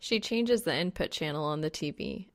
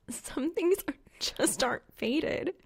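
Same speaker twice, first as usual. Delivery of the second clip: whispered, higher, mock-wise; a knowing whisper, pitched up and drawn out; sagely, between giggles; 9 semitones higher than usual. A narrator's reading. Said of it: "she says sagely, between giggles".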